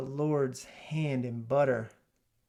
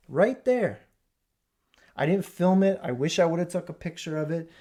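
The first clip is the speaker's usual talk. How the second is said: worked-up wonder; 4 semitones higher, 6 dB louder than usual. Real, not acted. Marvelling at the tissue.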